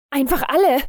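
viel höher und lauter herausgerufen; aufgeregter Ausruf. A narrator's reading. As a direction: aufgeregt hinausrufen. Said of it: "rief er aufgeregt aus". (aufgeregter, höherer Ruf)

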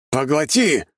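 restrained roar, high-pitched; stubborn roar. A narrator's reading. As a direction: push the command out stubbornly. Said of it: "упрямо взревел я".

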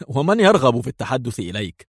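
usual speaking voice

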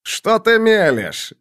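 high, drawn-out retort; exasperated outburst. A narrator's reading. Rot cannot bear Roderick's raving, exasperated high outburst. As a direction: lose patience and cry it out slowly. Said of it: "не выдержал Рот".

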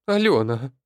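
loud, high strained cry; anguished shout. A narrator's reading. Mother calls after her fleeing daughter, anguished shout. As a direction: cry out the name desperately. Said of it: "надрывно крикнула Оксана".

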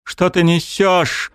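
high, slow furious crying scream; angry tearful shout. Acted. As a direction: scream it through snot and tears. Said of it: "зло вперемешку со слюнями и соплями кричала Элина".

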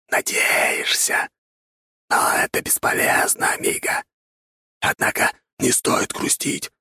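dry whispery skeleton rasp; cheerful bony rustle, half-whispered; rustling skeleton voice. A narrator's reading. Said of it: "весело прошелестел скелет".

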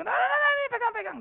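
very high, loud "here, take it"; hammed-up kind stranger pushing money; generous giver. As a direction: press the cash into their hands eagerly. Benefactor hands over money.

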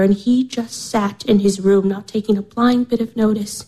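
normal talking voice

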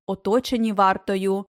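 ordinary delivery

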